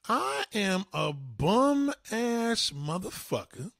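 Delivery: drawn-out mock amazement, swooping high; astonished realization. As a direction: stretch each word out, marveling in hammed-up disbelief. Acting the bum's astonished realization.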